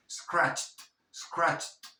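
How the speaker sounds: scratched as one syllable, "scratcht"; final D as T. Shown as a demonstration. Teacher drilling the past tense, scratched ending in t. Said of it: "te comes la E".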